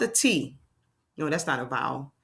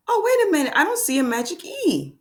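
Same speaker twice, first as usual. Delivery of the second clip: mock surprise; 10 semitones higher than usual. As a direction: gasp then high surprised oh. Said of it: "gasps, noticing no magic E".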